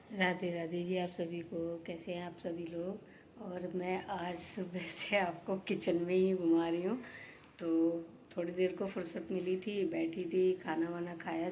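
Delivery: softly telling viewers about her short nap; hushed voice. Real, not acted. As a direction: keep it down while someone sleeps. बात करूँगी तो जग जाएंगी.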